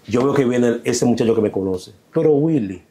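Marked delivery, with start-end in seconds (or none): 2.1-2.8 mock stern grab. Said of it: "Así, mira, mira cómo hizo".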